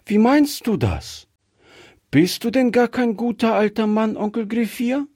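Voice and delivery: put-on very high, questioning child voice; puzzled little girl. A narrator's reading. Gretchen questioning whether uncle is good, very high puzzled child voice. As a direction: ask high and wondering, like a small child.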